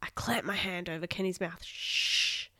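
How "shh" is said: urgent hushing whisper; breathy, voiceless hush. A narrator's reading. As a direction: shush them, then whisper the secret. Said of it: "I whispered".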